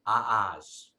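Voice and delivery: "ah-ahs", the letter R said as a drawn-out ah; dropped R's. Shown as a demonstration. Telling class what Rhode Islanders don't pronounce, "ah-ahs" with R's dropped. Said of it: "we don't pronounce".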